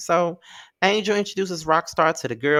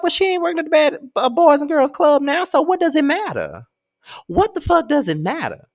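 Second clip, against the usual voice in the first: much higher, incredulous comeback; exasperated retort; 12 semitones higher, 4 dB louder than usual. Retold or imitated. Answering Lorenzo's excuse, exasperated and incredulous. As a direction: toss it back like it's obvious. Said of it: "And I'm just like".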